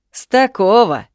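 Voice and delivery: high, loud mimicking jab; mocking retort. A narrator's reading. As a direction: throw his words back mockingly. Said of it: "передразнил отец". (передразнивая, громко и едко)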